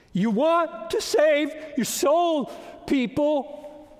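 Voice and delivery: preaching shout. Real, not acted. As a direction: call out to the whole room.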